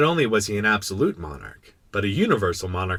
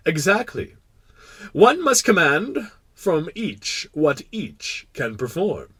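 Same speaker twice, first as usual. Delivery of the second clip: slow, higher royal pronouncement; sage pompous king; 7 semitones higher, 4 dB louder, slower than usual. Acted. King agreeing with the prince, pompous. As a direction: lay down a maxim as monarch.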